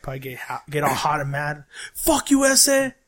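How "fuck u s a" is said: slow, higher, louder mock rant at the comic; hammed-up heckler yelling at the stage; mock heckler yelling from the crowd, slow and angry; mock angry heckle. Imagined angry Mexican heckler.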